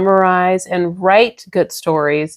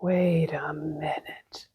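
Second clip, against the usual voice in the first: whisper; 6 semitones lower, 15 dB quieter, much slower than usual. Pretend. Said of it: "whispered echo".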